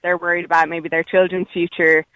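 ordinary talk

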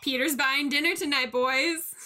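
proud announcement, lightly hammed; mock boast. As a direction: lightly act out a guy treating his boys. Quoting the happy character boasting.

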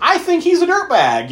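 high, loud mock verdict; dawning suspicion. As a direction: blurt the judgment, voice jumping up. Voicing viewer's dawning verdict.

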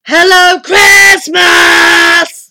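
huge high bellowed greeting; booming giant. A narrator's reading. Giant wizard greets his returning visitor, booming. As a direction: boom it out, stretching the name. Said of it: "boomed the giant voice".

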